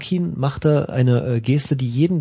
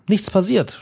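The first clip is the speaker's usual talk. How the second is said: relieved groan; 7 semitones higher than usual. A narrator's reading. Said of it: "stöhnte das Tomatengesicht erleichtert".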